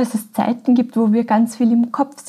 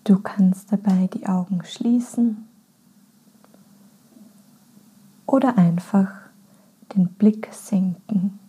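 guided meditation. Acted. Podcast host leads listeners into a body scan, calm and unhurried. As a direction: guide them gently inward, phrase by phrase.

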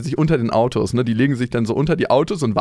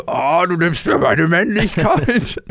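mock-indignant father, slow and a bit higher; really sour; 4 semitones higher, slower than usual. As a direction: imitate an aggrieved dad, dragging out the complaint. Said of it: "mein Vater richtig sauer so".

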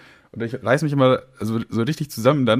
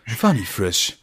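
slow announcer brand read; advertising voice; much slower than usual. Shown as a demonstration. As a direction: sell it like a TV commercial. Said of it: "machen wir jetzt Werbung für". (Werbesprecher-Stimme)

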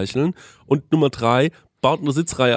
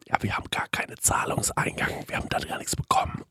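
quick toneless whisper; 9 dB quieter than usual. Retold or imitated. Waitress saying no payment arrived, whispered. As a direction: hurry the bad news out in a whisper.

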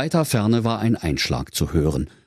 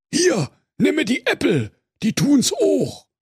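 much higher, brash Berlin youth bear; mock the bored wolf; high-pitched slangy bear teen; cheeky teen bear. Bear offers apples.

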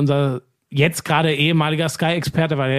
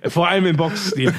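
laughing. Real, not acted. Joking he resembles Tyson Fury.

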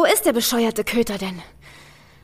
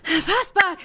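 panicked high calls to a dog; calling out; 5 semitones higher than usual. Acted. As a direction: call the dog, rising panic. Ruft den Hund panisch. (panisch rufend)

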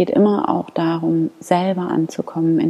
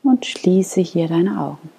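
guided meditation. Acted. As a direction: lead them gently into calm prayer. Leads a guided morning prayer.

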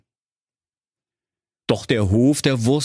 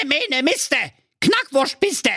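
very high chanted witch curse; witch's curse. Acted. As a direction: hurl the spell like a fury. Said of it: "schrie sie wie eine Furie". (sehr hoher, gesungener Hexenfluch)

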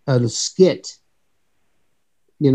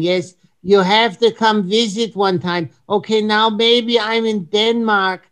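higher, mock European accent as Heinz; Heinz's accent; 4 semitones higher than usual. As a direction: put on Heinz's accent, politely chatty. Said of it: "the whole entire show in Heinz's accent".

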